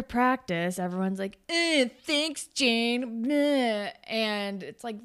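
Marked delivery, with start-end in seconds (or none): 1.5-3.9 sarcastic mock-grumble of thanks; mock grumbling. Punished teammates grumble at Jane after practice, mock grumbling. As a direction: imitate sulky teammates, a little raised.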